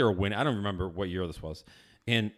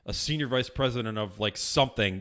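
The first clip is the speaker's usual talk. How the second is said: normal talking voice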